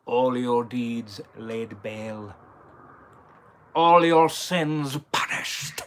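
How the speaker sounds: very slow, menacing sea villain; ominous villain voice, heavy pauses; Davy Jones. Shown as a demonstration. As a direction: draw out each word as a threat, dropping to a hush at the end. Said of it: "I'm gonna do a Davy Jones impression".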